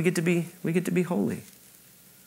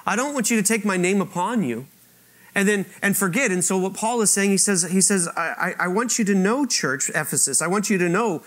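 higher, louder, swelling pulpit voice; impassioned preaching; 8 semitones higher, 4 dB louder than usual. Acted. Preacher telling the congregation God's name is on them, impassioned. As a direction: lift it toward the beautiful part.

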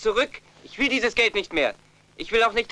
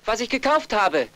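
normal talking voice

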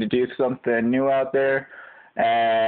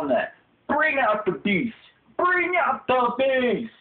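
higher-pitched, pumped-up gym hype; hype man; 10 semitones higher than usual. Acted. Trainer urging someone to bring out the beast, hyped up.

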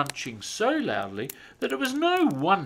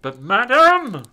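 loud, higher protesting Santa; blustering Santa; 10 semitones higher, 13 dB louder than usual. A narrator's reading. Santa arguing with the satnav, blustering. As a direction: bluster indignantly. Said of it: "Santa blustered".

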